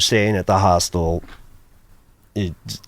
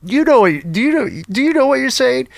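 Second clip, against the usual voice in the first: much higher, incredulous question; retold hostel worker. Hostel worker asking the guest about his German, imitated high. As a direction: imitate someone checking, pitched way up. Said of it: "She says".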